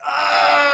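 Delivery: high, loud crowd shriek; crowd scream. Retold or imitated. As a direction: let out the audience's ecstatic scream. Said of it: "They went like".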